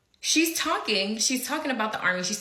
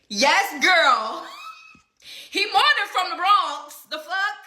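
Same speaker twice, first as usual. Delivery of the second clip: high and loud, laughing through it; gossiping, all hyped up; excited girl talk; 10 semitones higher, 6 dB louder, slower than usual. Retold or imitated. Telling her coworker about the ex, high, loud and laughing. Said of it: "I said".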